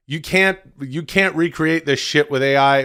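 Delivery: normal talking voice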